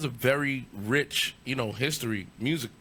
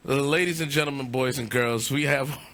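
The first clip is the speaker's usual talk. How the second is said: mock announcer intro. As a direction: announce the guest like a hype man. Host announcing guest intro.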